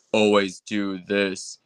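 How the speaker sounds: lekko forsowany, sztuczny głos; controlled voice. Shown as a demonstration. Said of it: "I can make it happen".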